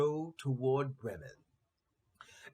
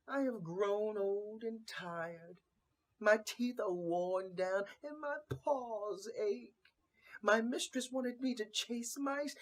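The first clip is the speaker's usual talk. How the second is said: put-on a sad old cat lamenting her fate, voice pitched up; tired old cat; 8 semitones higher than usual.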